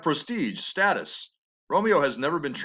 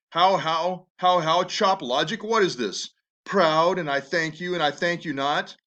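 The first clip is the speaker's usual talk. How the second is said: raised, mocking outburst at his daughter; furious father; 3 semitones higher than usual. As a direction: bark the words back at her in disbelief. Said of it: "Lord Capulet is just furious".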